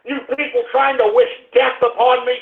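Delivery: ordinary talk, nothing marked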